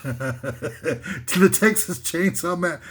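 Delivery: words squeezed out through real laughter; laughing at own joke. Real, not acted. Comic losing it over his own title list, laughing. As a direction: giggle through the title and give up. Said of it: "I'm laughing at myself".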